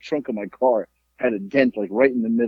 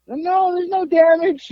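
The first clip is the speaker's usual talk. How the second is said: put-on slow, very high old-person voice; imitated old driver; 4 dB louder than usual. Old driver refusing to get out, slow high mock reassurance. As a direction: draw it out high as a dismissive old motorist. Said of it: "they were old people".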